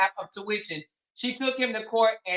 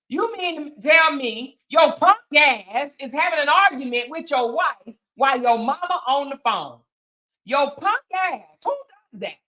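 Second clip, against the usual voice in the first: louder, emphatic scolding; scornful disbelief; 2 semitones higher, 3 dB louder than usual. Real, not acted. Commentator berates the husband over his mama listening, scornful disbelief. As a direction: tear into him, incredulous.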